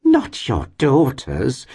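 high, slow, gushing disbelief; landlady's theatrical astonishment; astonished exclamation. A narrator's reading. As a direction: exclaim it in drawn-out amazement. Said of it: "exclaimed the lady".

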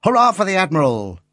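high-pitched hurrah; rousing little cheer; boyish cheer. A narrator's reading. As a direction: cheer like an excited boy, voice lifted. Boy cheers in the story.

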